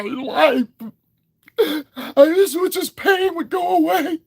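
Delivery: mocking high, whimpering fake-hurt voice; whiny oversensitive commenter. Mocking a sensitive commenter in a high, whiny voice. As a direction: ham up a wounded crybaby, way up high. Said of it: "That's how you fucking sound".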